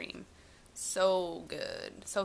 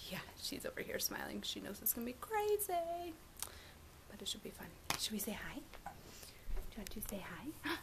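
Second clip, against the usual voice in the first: hushed whisper; 3 semitones higher, 7 dB quieter than usual. Real, not acted. Baby sister sleeping nearby.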